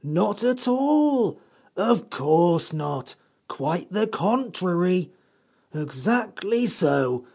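slow, drawn-out scornful sarcasm; withering irony. A narrator's reading. The Samiad mocking the children with slow withering irony. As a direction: lay the sarcasm on thick. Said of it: "with withering irony".

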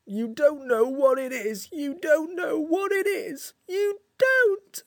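slow tearful wail climbing to a squeak; voice cracking with emotion. A narrator's reading. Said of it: "Its voice cracked with emotion".

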